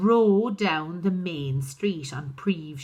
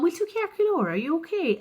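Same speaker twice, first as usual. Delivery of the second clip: high, lightly voiced caring father; Daddy at the door asking Kate if she's okay; 11 semitones higher, faster than usual. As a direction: ask it in a high, anxious sing-song. Father greets daughter.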